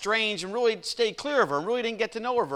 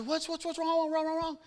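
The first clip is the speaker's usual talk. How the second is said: high, fluttery fake-innocent voice, higher pitch; mock innocent concern; 11 semitones higher, 4 dB quieter than usual. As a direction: play dumb, all wide-eyed worry. Family feigns innocence to neighbor.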